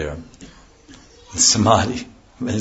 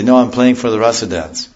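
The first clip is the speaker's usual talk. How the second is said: loud, higher insistent reply; drummer protesting; 4 semitones higher, 7 dB louder than usual. Retold or imitated. Drummer answering the angry neighbour, loud and insistent. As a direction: insist loudly you're playing for the dance. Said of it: "And he'll say".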